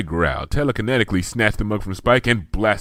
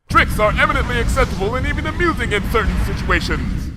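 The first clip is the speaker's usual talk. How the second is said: higher, grand archaic royal voice; regal Princess Luna; 13 semitones higher, 3 dB louder than usual. A narrator's reading. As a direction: declaim like a stately monarch. Princess Luna speaks formally.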